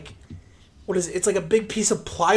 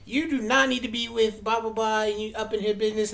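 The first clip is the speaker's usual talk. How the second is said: imitation of a flamboyant man ranting; higher-pitched, sassy mock tirade; flamboyant dude; 4 semitones higher than usual. Flamboyant dude telling off everyone in the laundromat, mock ranting. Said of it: "flamboyant dude came in".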